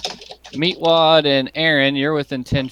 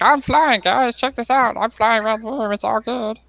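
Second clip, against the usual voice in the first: high-pitched, giddy show-off voice; flying kobold; 10 semitones higher than usual. Acted. Player voices his flying kobold showing off to the party, high and giddy. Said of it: "He's like".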